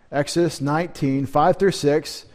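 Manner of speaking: normal talking voice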